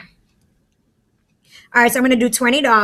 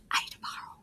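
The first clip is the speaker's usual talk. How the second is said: whispered little plea from the zero; a whispered request; zero asking to borrow; 18 dB quieter, faster than usual. Pretend. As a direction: whisper it like a shy number.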